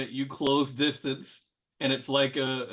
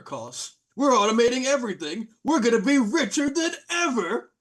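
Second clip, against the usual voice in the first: high villain gloat, building louder and higher; gloating robotic trolls; 6 semitones higher, 3 dB louder than usual. Acted. Robotic trolls announcing changes to the heroes, gloating. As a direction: gloat grandly, rising to a loud high finish. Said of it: "And they go".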